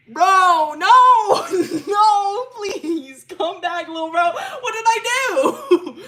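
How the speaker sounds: very loud, high mock-crying shouts; hammed-up wailing. [name] begging Ellie to come back, hammed-up wailing. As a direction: wail it melodramatically, stretching the last words.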